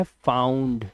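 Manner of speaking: फाउंड said low with an open "au" vowel; AU sound. Shown as a demonstration. Teacher gives the correct reading of the word, AU sound. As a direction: say फाउंड as "faa-oond", falling. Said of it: "आऊ की आवाज है, ना कि उआ की".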